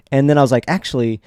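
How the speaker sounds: ordinary delivery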